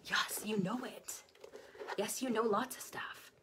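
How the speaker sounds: soft, hushed wonder; quietly surprised at yourself; amazed whisper. Retold or imitated. Student realizing she knows the answer, hushed amazement. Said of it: "you'd be like".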